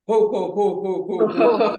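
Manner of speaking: loud, high, booming ho ho ho; hearty ho ho laugh. Shown as a demonstration. Leader demonstrates a loud ho ho laugh. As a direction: laugh out ho ho ho like Santa. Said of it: "you'll just, um, do the sound".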